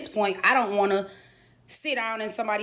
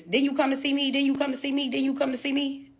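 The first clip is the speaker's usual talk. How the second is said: slightly raised, repetitive callout; higher-pitched, insistent retold question; imitated calling out; 5 semitones higher than usual. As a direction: lightly mimic someone pestering from afar. Imitates repeated heckle from stage.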